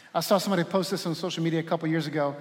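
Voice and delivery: ordinary delivery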